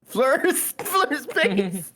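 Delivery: laughing shout. Real, not acted. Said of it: "That's so good".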